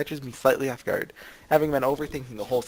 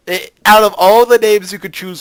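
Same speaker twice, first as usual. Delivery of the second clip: raised, loud protest; exasperated complaint; 7 semitones higher, 12 dB louder than usual. Real, not acted. Player berating the game's name choice, exasperated. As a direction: protest it higher and louder, in disbelief.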